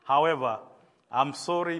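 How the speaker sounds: normal talking voice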